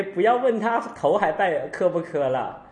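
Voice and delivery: ordinary delivery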